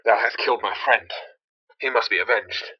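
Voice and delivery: normal talking voice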